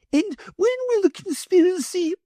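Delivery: high, sugary lady's voice; greedy wife in a high fluting voice; the Wardeness. A narrator's reading.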